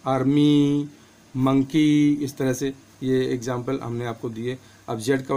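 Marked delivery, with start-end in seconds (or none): 0.1-2.1 आर्मी and मंकी louder, with a long final ee; long ee ending. Shown as a demonstration. Teacher repeating the example words for students, long final ee. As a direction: stretch the last ee of आर्मी and मंकी. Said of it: "बड़ी का उच्चारण हुआ है, यानी कि".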